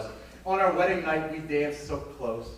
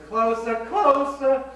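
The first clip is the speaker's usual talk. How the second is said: much higher, louder, slow and drawn out; swooning climax; 11 semitones higher, 11 dB louder, much slower than usual. Acted. Old lady reliving the dance, swooning much higher and louder. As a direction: melt into the memory, milking each closer.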